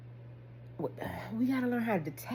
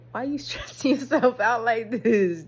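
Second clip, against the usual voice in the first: loud, exasperated self-scolding; raised-voice mock telling-off; confronting oneself; 3 semitones higher, 8 dB louder than usual. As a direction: call yourself out loudly. Confronts himself in self-talk.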